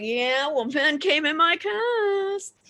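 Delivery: slow, climbing, stretched-out whine; mock wail. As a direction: let it rise into a drawn-out comic wail. Comic reply to the friends.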